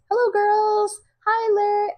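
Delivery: high, sing-song lady greeting; high cheerful neighbor voice. Acted. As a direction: chirp a cheery hello.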